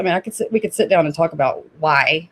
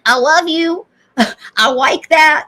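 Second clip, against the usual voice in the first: toddler imitation; 8 semitones higher, 5 dB louder than usual. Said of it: "He's like".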